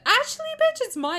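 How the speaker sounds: high, loud sassy snap. Real, not acted. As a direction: throw attitude. Sassy reversal claiming the year.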